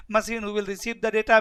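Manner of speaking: ordinary delivery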